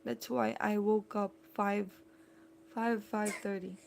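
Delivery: ordinary delivery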